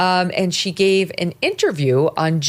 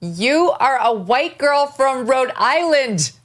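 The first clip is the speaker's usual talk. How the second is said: high, slow disbelief; incredulous; 5 semitones higher, slower than usual. Acted. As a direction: spell out the absurdity. Mock outrage at her accent.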